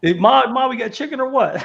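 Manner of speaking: high, loud movie-quote holler; imitated holler across the house; mock shout. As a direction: yell it to Ma, hammed up. Quoting a movie character calling out.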